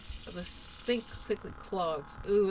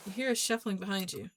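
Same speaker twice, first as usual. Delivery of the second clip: ordinary talk, nothing marked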